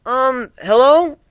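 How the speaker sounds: high, loud, slow uncertain call; Twilight calling. A narrator's reading. Twilight calls to the unseen voice, hesitant. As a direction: call out into the dark, unsure. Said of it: "Twilight asked".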